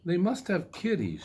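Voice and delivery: a hint of a curious young girl; Goldilocks' voice. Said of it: "she said".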